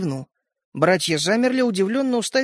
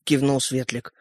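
plain reading voice

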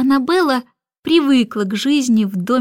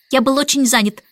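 usual speaking voice

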